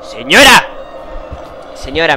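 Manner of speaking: loud, rising panicked calls to the woman; frightened shouting at the lady; scared calling out. Real, not acted. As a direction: call after her, spooked and loud.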